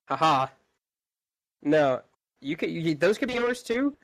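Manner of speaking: ordinary delivery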